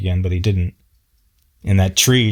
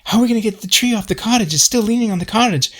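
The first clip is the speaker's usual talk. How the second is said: high, fast, fretful mock voice; high-pitched anxious neighbour asking about the tree; fussy Robert; 12 semitones higher, faster than usual. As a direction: squeak it out like a nervous busybody. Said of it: "Robert's asking him".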